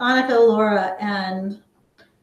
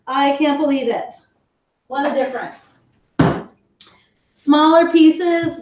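ordinary talk, nothing marked

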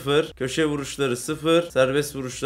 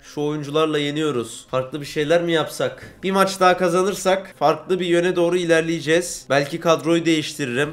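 ordinary talk, nothing marked

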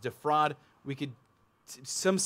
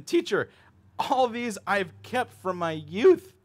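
higher, louder, slow proud claim; proud young man; 7 semitones higher, 6 dB louder, slower than usual. Acted. Young ruler answering Jesus, proud and slow. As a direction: announce it proudly and slowly. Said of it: "he said to him".

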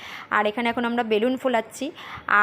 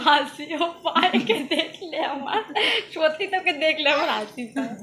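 laughing; 5 semitones higher than usual. Real, not acted. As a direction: chuckle through the words. Mother laughing at child. (হাসতে হাসতে বলা)

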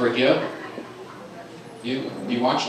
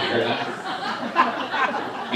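high, drawn-out wary dog voice; laughing high dog whisper; suspicious dog; 11 semitones higher, 4 dB quieter, much slower than usual. Pretend. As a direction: stretch it out like a puzzled dog. Dog voice, stretched.

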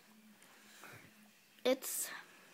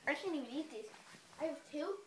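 ordinary delivery

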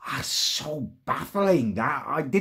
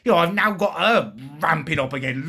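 normal talking voice

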